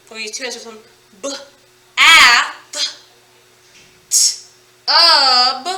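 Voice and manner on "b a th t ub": each sound alone with pauses, vowels punched loud; slow, separated phonics sounds. Shown as a demonstration. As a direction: tap out bathtub sound by sound. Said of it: "tap it out".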